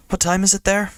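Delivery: high-pitched schoolgirl character voice; Lily asking. Acted. Lily on the phone from Scotland, high-pitched question.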